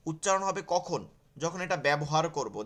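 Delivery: ordinary talk, nothing marked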